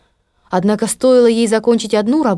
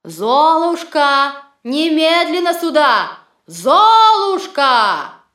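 slow, drawn-out piercing call; shrill call; 6 semitones higher, much slower than usual. Acted. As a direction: summon her from afar, stretched. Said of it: "пронзительный голос мачехи".

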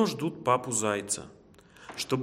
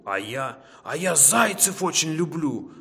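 growling wolf; 4 semitones higher, 4 dB louder than usual. A narrator's reading. Wolf about to pounce on the hare, loud growl. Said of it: "зарычал волк".

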